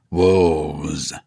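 ordinary delivery

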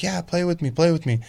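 put-on high, eager pleading kid voice; ecstatic kid. Dad mimicking his ecstatic son begging to play, high and eager. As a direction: beg to play like an overjoyed little boy. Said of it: "he's just so ecstatic about it".

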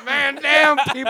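high, loud urgent callout; man down shout. Pretend. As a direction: yell it across the battlefield. Mock military callout.

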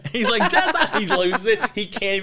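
high, loud, breaking into laughter; can't get the words out for laughing; retelling the blooper through laughs; laughing while telling. Real, not acted.

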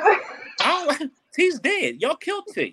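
high, breaking into laughter; laughing. Real, not acted. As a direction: crack up while saying it. Cracking up after friend's joke.

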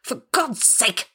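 slow, high, strained exasperation; repressed rage. A narrator's reading. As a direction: hold back rage on drawn-out words. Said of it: "trying to repress the blinding rage".

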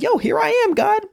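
laid-back dude greeting God, up high; casual Moses. Retold or imitated. Moses answering God's call, high and casual. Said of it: "He was like".

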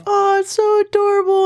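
squealing over something adorable; high gushing coo; cutesy high voice. Retold or imitated. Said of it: "It was like".

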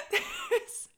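high, loud and drawn out, on the edge of laughing; real startled giggle creeping in; breaking into laughter. Real, not acted. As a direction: let laughter rise as you get it out. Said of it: "Why am I laughing".

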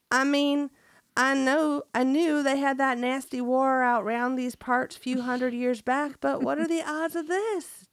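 hammed-up Southern farmer, fighting back a laugh; best country accent. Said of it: "That was my best country accent".

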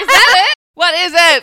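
high, loud questions through laughter; laughing loudly. Real, not acted. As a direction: ask while cracking up. Laughing after a funny guess.

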